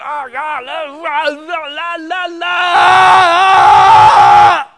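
high-pitched screamed babble; loud, high screaming la-la-la. Acted. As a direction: yell nonsense at full volume, drowning everything out. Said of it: "the world is just screaming at them".

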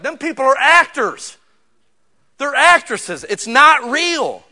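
forceful preaching. Real, not acted.

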